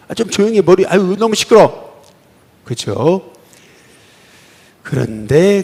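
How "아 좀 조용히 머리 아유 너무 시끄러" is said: high, loud irritated mock complaint; annoyed crowd. Preacher voicing the crowd scolding the blind man, loud irritated whine. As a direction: play a fed-up bystander griping about noise.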